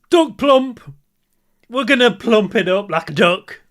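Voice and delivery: high, loud advert voice; hammy product shout. Acted. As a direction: announce it like a cheesy TV ad. Hyping a lip product.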